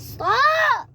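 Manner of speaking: shouting. Real, not acted. Kid protesting being teased.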